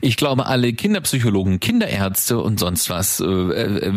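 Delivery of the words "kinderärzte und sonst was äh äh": ordinary talk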